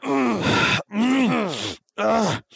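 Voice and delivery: high, imitated crying grunts from the audience; mock sobbing groans. A crying moviegoer in the theater, high mock sobbing groans. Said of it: "people in there going".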